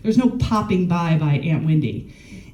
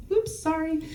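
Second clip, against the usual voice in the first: very high, soft little oops; mock innocent hang-up; 11 semitones higher, 7 dB quieter than usual. Pretending to apologise while hanging up on dad, high and soft. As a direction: toss off a sweet fake apology. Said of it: "I used to hang up the phone".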